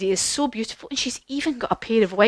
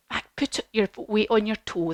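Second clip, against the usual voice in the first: usual speaking voice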